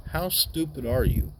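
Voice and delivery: scolding lecture. Retold or imitated. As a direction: scold the kid sharply with a high pitch. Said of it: "He goes".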